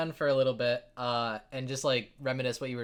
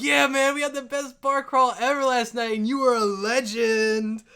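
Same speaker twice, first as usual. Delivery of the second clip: loud, high, hyped-up bragging; rowdy excitement; 10 semitones higher, 10 dB louder than usual. Acted. Dwarves tell Wilhelm about last night, rowdy excitement. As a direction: gush at full volume, stretching the final words.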